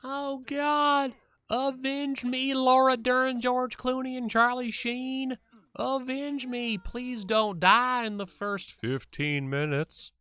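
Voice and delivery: high, loud, wailing death scene; overacted death scene. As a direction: ham up a melodramatic dying farewell. Streamer voices dying bear, mock-dramatic.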